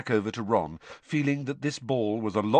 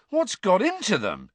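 higher, baffled demand; 12 semitones higher, 4 dB louder than usual. Acted. Ron baffled by the girls, demanding. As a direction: demand an explanation, pitched up. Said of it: "he demanded".

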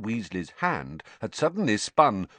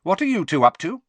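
a sharp, suspicious demand, higher and louder; 11 semitones higher, 8 dB louder, faster than usual. A narrator's reading. Mother sharply questioning her scheming twin sons. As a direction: snap the question at the twins. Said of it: "said Missus Weasley sharply".

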